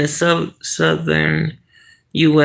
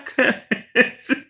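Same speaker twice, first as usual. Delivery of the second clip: quiet high breathy giggle; soft giggle; 7 semitones higher, 5 dB quieter than usual. Real, not acted. Laughing at a viewer's summer blanket question. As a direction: let out a little giggle.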